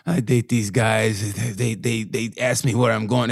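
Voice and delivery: put-on low Ukrainian-accented woman; imitated Ukrainian accent. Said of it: "act like I was imitating her voice".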